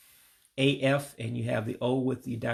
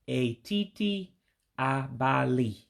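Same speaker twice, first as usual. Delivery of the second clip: "etiti", pause, "abali", slowly; slow and pitched up on "etiti", down on abali; slow demonstration; slower than usual. Shown as a demonstration. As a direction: break "etiti abali" into careful halves. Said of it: "Now say it slow".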